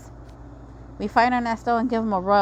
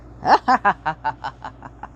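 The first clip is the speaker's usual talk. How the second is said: evil laugh; 3 semitones lower, 5 dB louder than usual. Acted. Villain laugh ending speech.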